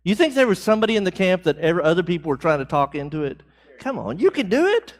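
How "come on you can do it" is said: coaxing comrade. Acted. Soldiers coaxing a volunteer in camp.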